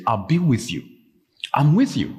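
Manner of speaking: usual speaking voice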